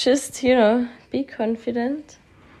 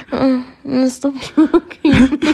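laughing; 2 semitones higher, 7 dB louder, much slower than usual. Real, not acted. Laughing protest.

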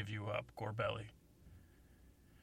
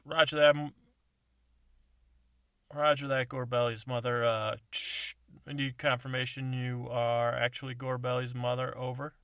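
over CB radio; 3 semitones higher, 10 dB louder than usual. Acted. CB radio reply.

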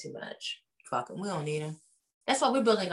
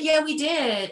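a higher, lightly put-on voice; high mock agreement; 8 semitones higher, 3 dB louder, slower than usual. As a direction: toss off the yes in a raised voice. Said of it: "She's like".

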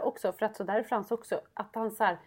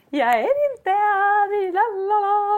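much higher, loud, slow sing-song denial; fake cheerful; 10 semitones higher, 7 dB louder, slower than usual. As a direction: sing it in forced, sugary cheer. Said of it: "stå då och vara så här".

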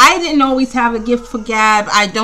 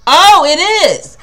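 surprised discovery; 6 semitones higher, 6 dB louder than usual. Real, not acted. Discovers the magazine is real.

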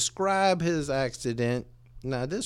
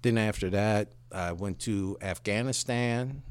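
lightly voiced dog, lower and softer; a hint of a proud old soldier dog; dog recounting its service, lower and softer; talking dog; 8 semitones lower, 5 dB quieter than usual. Talking dog tells its story.

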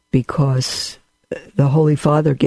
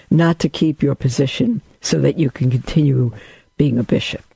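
ordinary talk, nothing marked

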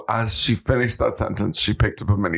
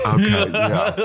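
laughing; 12 semitones higher, much slower than usual. Real, not acted. Laughing reply in chat.

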